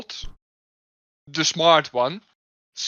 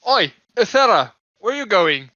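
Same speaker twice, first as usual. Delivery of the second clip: raised, higher calling voice; shouting after someone; 4 semitones higher, 6 dB louder than usual. Acted. Watchman calls to companion wandering off at night, shouting. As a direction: call out to someone walking away. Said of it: "does this shouting wake up the rest".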